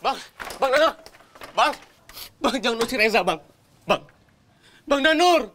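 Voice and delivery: high, distressed pleading cries; desperate calling out. Acted. Younger brother shut out and begging Danur, desperate.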